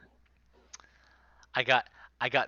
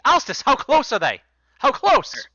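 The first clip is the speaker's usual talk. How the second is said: high, loud call across the room; yelling over; 10 semitones higher, 8 dB louder than usual. Acted. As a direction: call out to the lookout. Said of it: "yells over to you".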